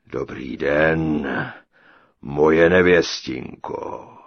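slow, low, booming giant; thundering giant. A narrator's reading. Giant greets his captive bride, slow thundering voice. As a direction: boom it out slowly and deep. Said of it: "zahromoval obr".

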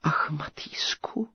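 breathy, tearful sigh. A narrator's reading. The captive princess sighing for Matýsek. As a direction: sigh his name in longing. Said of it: "vzdychla knížecí dcera".

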